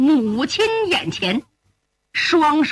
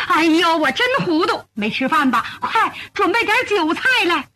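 higher, stop-start fussing mother; flustered doting mother; 4 semitones higher than usual. Acted. Mother ordering food for returned son, flustered and doting. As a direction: fuss excitedly, changing your orders as you go.

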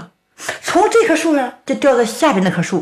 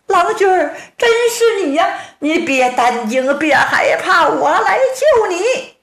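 高音女子腔调; 急切地叫丈夫别怕; 妻子急切安慰; 6 semitones higher than usual. A narrator's reading. 杨赛花安慰并救丈夫. (女声急切安慰)

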